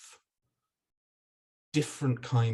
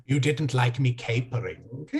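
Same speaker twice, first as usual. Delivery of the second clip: usual speaking voice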